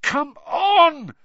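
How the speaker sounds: high, loud, fierce cry; fierce shout. A narrator's reading. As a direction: burst out fiercely, pitched right up. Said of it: "Harry said fiercely".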